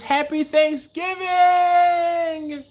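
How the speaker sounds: high, slow, loud festive shout; hammed-up holiday cheer. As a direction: belt it out like a cheery greeting. Reading Laura's chat, hammed up.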